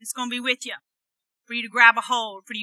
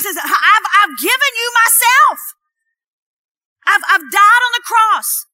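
loud, driving sermon voice, pitched up; full-force preaching; 5 semitones higher, 6 dB louder than usual. Acted. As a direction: preach it hard to the congregation.

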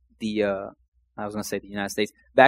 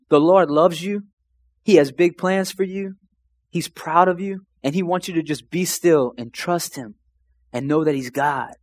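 high, emotional retelling of a crying man's words; imitated tearful pastor; 5 semitones higher than usual. Crying pastor passing on God's message, high imitated tearful voice. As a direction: start high and raised, easing down toward the end. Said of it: "he go, and he's crying".

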